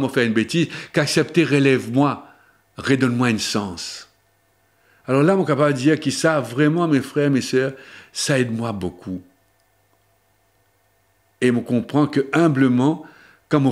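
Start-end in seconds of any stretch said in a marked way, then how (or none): none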